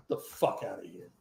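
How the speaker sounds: quiet scornful send-off; low dismissal. Real, not acted. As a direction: toss it off under your breath. Host dismisses after rant.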